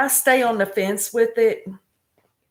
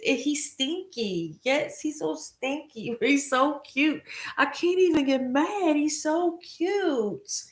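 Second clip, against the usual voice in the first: high, squeaky gushing; overwhelmed by cuteness; 6 semitones higher than usual. Real, not acted. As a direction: melt over the kitten, high-pitched. Gushing over cute cat.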